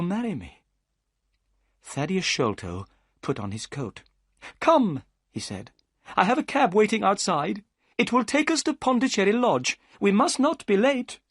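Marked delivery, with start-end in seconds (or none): 4.6-5.0 high, eager gentleman's voice; Thaddeus Sholto. A narrator's reading. Thaddeus urges them to leave. 6.2-11.2 high, eager gentleman's voice; Thaddeus Sholto. A narrator's reading. Thaddeus urges them to leave.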